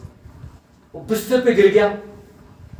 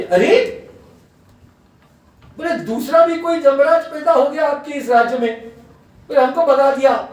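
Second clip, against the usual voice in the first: high, loud acted protest; indignant complaint; 9 semitones higher, 4 dB louder than usual. Yama's messengers report to their king that they were chased off, indignant. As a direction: imitate aggrieved messengers protesting.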